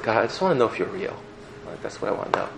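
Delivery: softly pleading prayer, barely voiced; quiet prayer. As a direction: pray it under your breath.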